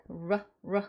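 르 as a short rounded English "ruh"; English R sound. Shown as a demonstration. Teacher sounding out R for learners, 르 as English ruh. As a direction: give 르 twice as an isolated R sound. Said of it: "소리 나고".

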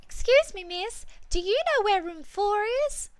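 put-on high, loud little-girl voice; imitating a young girl. Usher mimics a customer, high girly voice. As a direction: squeak it up like a small kid asking. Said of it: "imitated a young girl".

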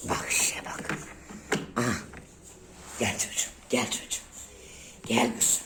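whispered calling to the pet; coaxing whisper. Real, not acted. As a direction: coax her near in a whisper. (fısıldayarak çağırma)

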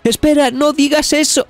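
grito muy agudo, interrumpiendo asustada; grito de súplica. A narrator's reading. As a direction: cortarle la frase al viejo a gritos. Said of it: "le grita". (Nami interrumpe al viejo gritando asustada)

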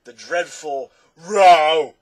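loud, very drawn-out wail; anguished cry. A narrator's reading. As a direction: wail it in anguish, stretching each word. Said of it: "cried the anguished Din".

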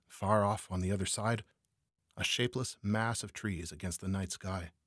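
ordinary delivery

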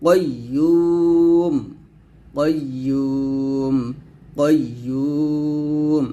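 কাইয়্যুম with a thick back "qo" and long "yuu"; heavy qaf. Shown as a demonstration. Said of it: "কফটা মোটা করে বলতে হবে". (কাইয়্যুম-এর কফ মোটা, ইউ টেনে)

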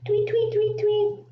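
high chirpy tweets; bird tweets. Shown as a demonstration. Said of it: "I guess not today".

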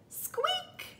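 tiny, very high squeak; mouse squeak. A narrator's reading. Mouse answering Duck's hello, very high squeak. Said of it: "said Mouse".

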